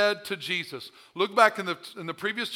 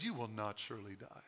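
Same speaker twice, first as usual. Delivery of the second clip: much lower, much softer sly hush; low sly tempter; 11 semitones lower, 19 dB quieter than usual. Acted. The devil telling Eve, low and hushed. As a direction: slide the lie in low and quiet.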